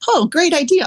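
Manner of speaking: light up at a good idea; bright delight. Retold or imitated. Woman thanking her friend for the candle, brightly delighted.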